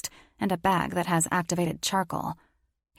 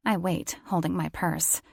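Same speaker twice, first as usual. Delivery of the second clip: usual speaking voice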